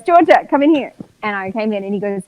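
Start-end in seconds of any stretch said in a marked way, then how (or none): 0.0-0.9 raised, high call across the office; loud, higher-pitched retold summons; mock summons. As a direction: imitate a boss calling someone in. Retold boss calling her in.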